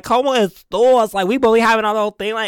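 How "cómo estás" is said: high, loud, slow catchphrase; playful diva. Acted. Host bantering with imagined friend, loud diva call. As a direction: belt it slowly with attitude. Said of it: "I'm gonna be like".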